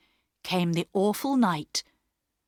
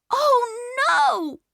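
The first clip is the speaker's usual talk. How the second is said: put-on much higher, loud crying-out girl; frightened cry; 5 dB louder than usual. A narrator's reading. A sister crying out from the darkness, high and alarmed. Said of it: "cried a voice from the darkness".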